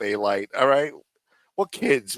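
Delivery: ordinary talk, nothing marked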